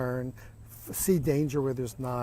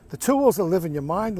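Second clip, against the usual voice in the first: put-on incredulous child; 4 semitones higher, 6 dB louder than usual. Boy's incredulous reply in story.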